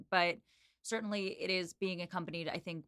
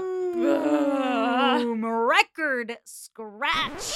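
loud, high, drawn-out boom; dramatic boom; 6 semitones higher, 11 dB louder, much slower than usual. Acted. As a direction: announce the bombshell with a big slow shout. Dramatic reveal reaction.

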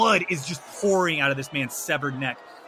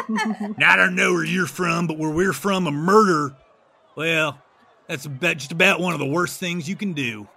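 lightly voiced small-town lawman; a hint of a folksy city guard. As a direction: deliver a mild, measured reproach. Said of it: "City guard looks back at you and they say".

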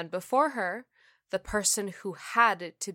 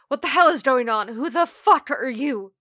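fast, high, loud demand; yelling; 6 semitones higher, 11 dB louder than usual. Acted. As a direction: shout in alarmed confusion. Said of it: "Ruthie yelled".

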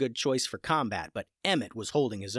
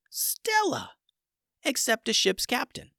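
plain reading voice

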